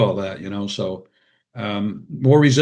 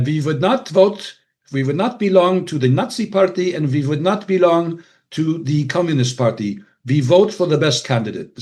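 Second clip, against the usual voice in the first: put-on Hungarian accent; 4 semitones higher than usual. Quoting mom on which party to vote for, Hungarian accent. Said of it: "forgive my Hungarian accent".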